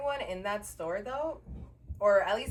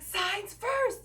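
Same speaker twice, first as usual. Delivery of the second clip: high-pitched mock yell; imitating a yelling man; 13 semitones higher, 4 dB louder than usual. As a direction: mimic his insistent demand, pitched way up. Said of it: "he's still screaming at me".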